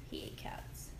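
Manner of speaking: hushed, breathy aside; whispering. Real, not acted. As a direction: whisper it like a secret. Creepy aside about Alf.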